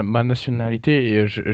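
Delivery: normal talking voice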